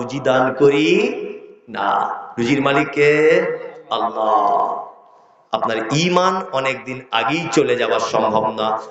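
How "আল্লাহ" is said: ordinary talk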